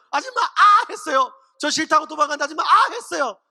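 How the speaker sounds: hammed-up panicked foreigner Korean; frantic protest. Joseph retells fleeing the woman, frantic mock foreigner accent. Joseph's testimony reaches its climax.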